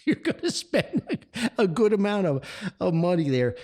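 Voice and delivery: amused, laughing warning about the cost. Real, not acted. Joking about costly visit.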